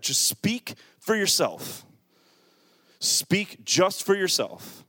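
each word laid down slowly; slow emphatic stress. Real, not acted. Pastor pressing the congregation, slow and deliberate. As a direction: spell it out word by word.

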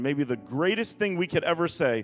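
dramatic pulpit reading, stretching weighty words; emphatic scripture reading. Acted. Preacher reading the storm and tombs story to church, emphatic preaching.

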